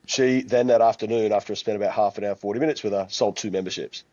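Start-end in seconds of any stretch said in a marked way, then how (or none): none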